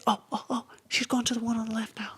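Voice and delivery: high, fast, thrilled whisper; quick hushed relief; excited whisper. Retold or imitated. Teammate whispers that she's turned left.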